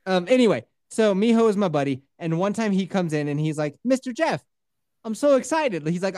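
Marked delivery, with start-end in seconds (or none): 3.8-5.9 put-on higher excited kid with Italian lilt; mock Italian-sounding accent. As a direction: imitate an eager boy, sounding Italian. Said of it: "Sounds Italian".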